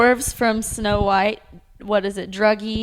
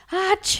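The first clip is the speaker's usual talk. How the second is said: sneeze imitation; 10 semitones higher than usual. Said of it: "had to do with that".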